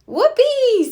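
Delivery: high, loud joyful cheer; excited whoop. A narrator's reading. Franklin cheering at Great Aunt Harriet's gift, thrilled. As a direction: shout it for pure joy. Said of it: "said Franklin".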